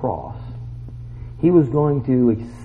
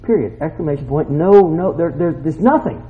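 ordinary talk

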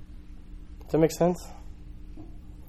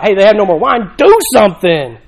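pleading Mary; 8 dB louder than usual. Retold or imitated. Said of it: "That's what Mary thought".

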